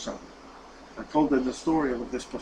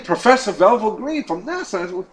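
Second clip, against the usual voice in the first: higher and louder, declaring it; proud announcement; 7 semitones higher, 7 dB louder than usual. Retold or imitated. Professor answers fellow passengers, loud proud declaration. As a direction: puff up and announce the title.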